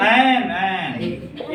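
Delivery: laughing while echoing the student's एन, एन; amused, slow imitation of the answer; mocking student. Teacher laughs at the student's odd answer, repeating it. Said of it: "ये कैसे बोल रहे हो यार".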